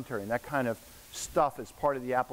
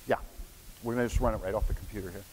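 ordinary delivery

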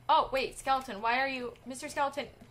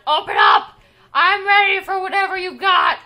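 loud bring-it-on yell; shouted challenge; 4 semitones higher, 8 dB louder than usual. Real, not acted. Gamer at the elevator doors, shouted challenge. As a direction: call it out at full volume.

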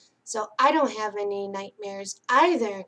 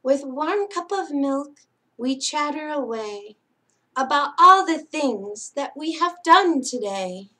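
louder, lilting read-aloud for little ones; singsong picture-book reading; bedtime story reading; 3 semitones higher, 6 dB louder than usual. Acted. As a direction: read it like a lullaby to sleepy kids.

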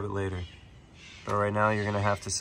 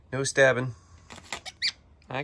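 normal talking voice

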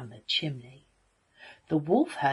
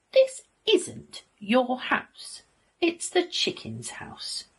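slow, high, emphatic wolf; indignant telling-off; 8 semitones higher, slower than usual. A narrator's reading. Wolf scolding the pig for the chickens, indignant. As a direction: spell it out slowly, pitched up.

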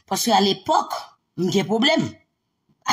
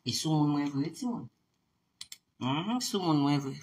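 softer, lower, half under the breath; muttered aside; 6 semitones lower, 10 dB quieter than usual. Real, not acted. Aside to listeners about the friend's call, softly muttered. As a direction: mutter it to yourself.

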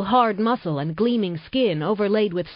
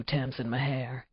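Nate, low and intense; 3 semitones lower than usual. Acted. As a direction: speak low and close, a man confessing his love. Nate's low male voice.

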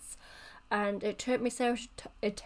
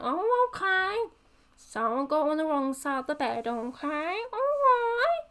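huffy comeback; 6 semitones higher than usual. Acted. Snubbed gossip answering the sceptic, high huffy voice. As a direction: lilt it up in exaggerated huff.

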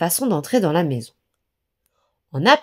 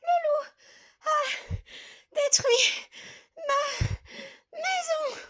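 much higher, softer breathless piglet; panting; 9 dB quieter, much slower than usual. A narrator's reading. The pig fleeing to his brother's house, high and panting. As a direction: gasp it out, fleeing in fear. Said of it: "en haletant".

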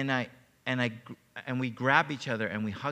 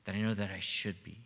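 retold whisper; 4 semitones lower, 6 dB quieter than usual. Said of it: "I whispered in her ear".

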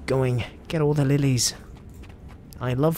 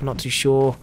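ordinary talk

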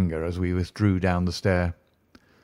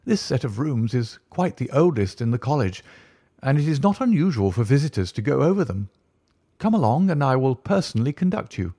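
higher-pitched, eager tutor voice; a lightly higher, keen Soames; fussy college tutor; 5 semitones higher than usual. Acted. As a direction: play the obliging don showing guests round.

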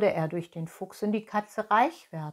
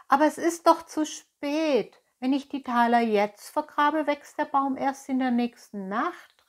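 higher, louder anxious protest; worried cry; 6 semitones higher, 4 dB louder than usual. Acted. Pinocchio protests to fox and cat, higher and louder. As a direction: call out fretting it is too late. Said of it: "rief Pinocchio".

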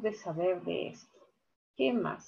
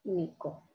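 "Único" split as U... nico, softer; stress on first syllable; 5 semitones lower, 9 dB quieter than usual. Shown as a demonstration. Teacher counting syllables of "Único", first-syllable stress. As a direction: hold U in "Único", then pause. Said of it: "Uno, dos, tres".

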